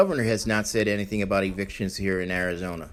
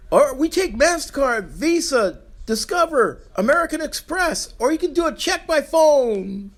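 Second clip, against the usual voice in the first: mock televangelist. Mocking Kenneth Copeland on TV, high preacher parody. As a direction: ham up a TV healer begging for money. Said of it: "this fucker on T V".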